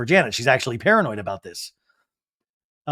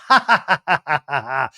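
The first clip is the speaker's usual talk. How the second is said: imitated laugh; 4 dB louder than usual. Imitating Kristen laughing at Zach's remark, mock laugh. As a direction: act out a staccato laugh that drops off. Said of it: "And Kristen's".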